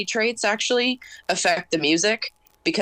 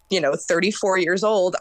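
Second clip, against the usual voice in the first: normal talking voice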